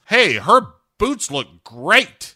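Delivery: exaggerated slow cartoon delivery; Grumpy Cat. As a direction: stretch the compliment out slowly, hammed-up. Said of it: "Grumpy Cat says".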